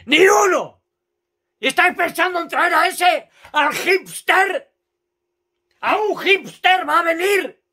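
loud, high mocking rant; furious shouting. Real, not acted. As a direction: shout at the club. Shouting at the board.

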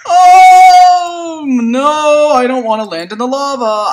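fearful cry that settles; panicked oh no about the lava. Real, not acted.

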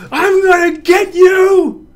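high, loud playful threat; excited shout. Shown as a demonstration. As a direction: yell it with glee. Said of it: "that's not as fun as this one".

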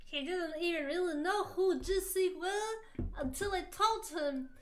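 hammed-up mob boss voice; loud, put-on mafia don character; unintended crime-boss impression; Godfather. A narrator's reading. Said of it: "You sound like the Godfather".

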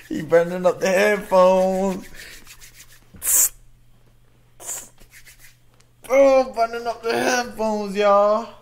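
high-pitched, loud amazement; hyped reaction. Real, not acted. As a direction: exclaim it, thrilled by the verse.

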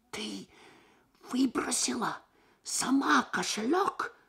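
plain reading voice

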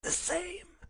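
very high, thin answer; feeble invalid. Acted. Sick Holmes names his illness, feeble voice. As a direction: squeeze out a weak reply.

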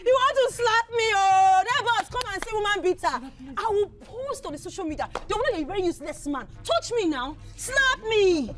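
high, loud taunting yell; provoking shout. Acted. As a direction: dare him to hit you, shouting. Wife shouting, daring husband to slap.

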